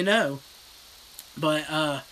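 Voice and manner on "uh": ordinary talk, nothing marked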